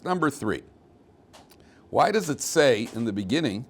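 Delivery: ordinary talk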